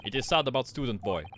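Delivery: put-on proud guard in a Russian accent. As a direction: boast about first class in a Russian accent.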